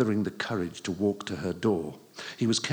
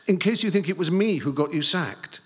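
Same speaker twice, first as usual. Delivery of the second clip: put-on high and louder, anxious boy; anxious pleading; 9 semitones higher, 6 dB louder than usual. Boy assuring his teacher, high anxious plea. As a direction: plead your innocence.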